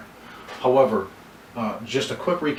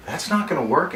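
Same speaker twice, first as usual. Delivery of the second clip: higher, troubled no; genuine concern; 5 semitones higher than usual. Shown as a demonstration. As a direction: show real worry over the terms. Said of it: "say it with as much genuine concern".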